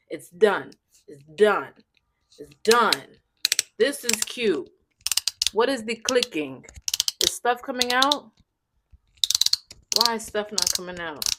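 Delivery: normal talking voice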